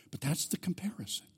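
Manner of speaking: whispered aside, half to himself. Real, not acted. Pastor whispers an aside to the congregation.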